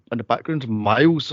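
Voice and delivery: normal talking voice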